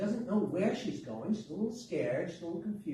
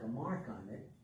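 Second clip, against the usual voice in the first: usual speaking voice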